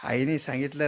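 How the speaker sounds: ordinary talk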